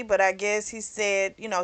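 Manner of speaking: ordinary talk, nothing marked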